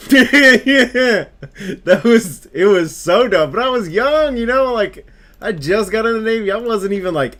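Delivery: high and loud, laughing through the words; cracking up while you admit it. Real, not acted. Recalling swallowing wasabi peas, laughing hard. Laughing at his own dumb story.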